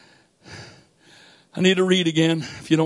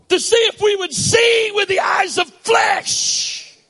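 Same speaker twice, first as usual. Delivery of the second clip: full-force preaching; 5 semitones higher than usual. Acted. Preacher calling the congregation to love, fervent preaching. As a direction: preach it out to the whole church.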